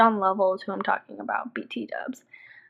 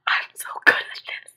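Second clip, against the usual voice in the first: breathy whispered self-congratulation; hushed, delighted aside to yourself; gloating whisper. Retold or imitated. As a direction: whisper it like a secret boast. Gloating to herself in retold win.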